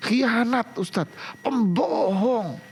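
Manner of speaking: imitating a wife crying betrayal, high and drawn out; mock accusing wife. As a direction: overact her accusation, stretching pembohong. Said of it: "udah keluar bahasa ibu-ibu".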